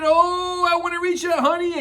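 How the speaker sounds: high, fast straining reach; eager Pooh. Acted. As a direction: stretch for the honey.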